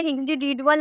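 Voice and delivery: इंडिविजुअल misread with hard "d" for "j"; wrong d sound. Shown as a demonstration. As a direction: end इंडिविजुअल in "dual", no "j" sound. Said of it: "नहीं बोलते हैं".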